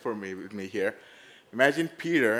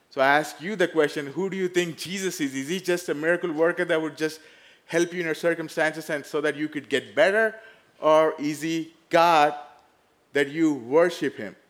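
louder, higher, pressing preacher voice; preaching; 5 semitones higher, 7 dB louder than usual. Acted. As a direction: press the congregation with the question. Preaching at full force.

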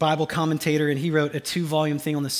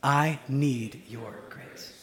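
very slow, dropping softer on the repeat; a quieter second take for all to echo; slower and softer; 4 semitones lower, 7 dB quieter, much slower than usual. Shown as a demonstration. Preacher repeating the phrase softly for the congregation. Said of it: "I just want us to say together".

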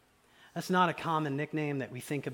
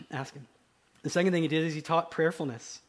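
ordinary talk, nothing marked

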